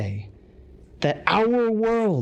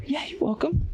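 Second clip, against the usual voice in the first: whispered aside; 9 semitones higher than usual. Real, not acted.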